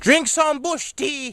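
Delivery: put-on an old grandmother prescribing her cure; grandma voice. Grandma answers every complaint.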